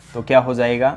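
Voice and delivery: ordinary delivery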